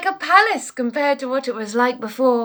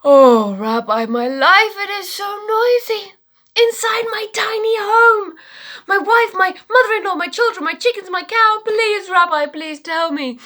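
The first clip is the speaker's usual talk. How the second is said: high pleading wail to the rabbi; desperate pleading man; 9 semitones higher, 3 dB louder than usual. Acted. Poor man begging his rabbi for help, high pleading wail.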